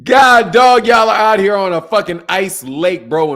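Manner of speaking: can't believe what he's seeing; incredulous outburst. Real, not acted. Streamer yelling at skaters on an ice lake.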